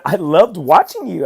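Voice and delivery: retold proud insistence, drawn out; emphatic praise. Dad telling his daughter he loved her race, emphatic praise.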